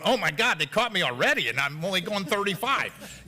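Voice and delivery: exaggerated, high-pitched exclamation of dismay; imitated alarmed rider. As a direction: pitch it up like a startled motorcyclist caught speeding. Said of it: "they're going".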